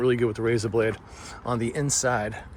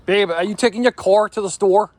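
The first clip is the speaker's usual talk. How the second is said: higher, louder, careful R's on "car" and "store"; R's pronounced; 8 semitones higher, 7 dB louder than usual. Shown as a demonstration. Husband trying out pronounced R's on his wife. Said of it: "watch how weird this sounds when I do use it".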